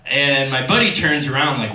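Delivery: ordinary delivery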